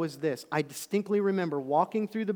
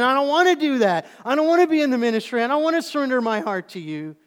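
loud, high protest; refusing loudly; 9 semitones higher, 11 dB louder than usual. Retold or imitated. Young preacher refusing God's call, loud protest. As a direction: push back hard, loud and fast. Voicing his own refusal.

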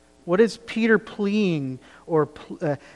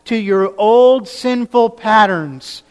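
loud, higher, slow pleading; begging plea; 4 semitones higher, 9 dB louder, slower than usual. Retold or imitated. Peter begging the church not to return to sin, loud and slow. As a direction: beg them not to go back, with all your heart. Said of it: "And he's begging them".

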